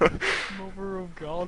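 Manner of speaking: shocked. Real, not acted. Reacting to the text.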